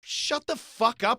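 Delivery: loud, high, drawn-out shutdown; retold furious put-down; angry retort. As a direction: hit each word slowly, fed up.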